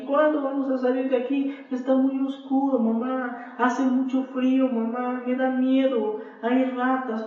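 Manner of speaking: put-on high pleading child's voice, tearful; crying little girl. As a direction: beg like a scared crying child. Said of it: "la niña empezó a llorar".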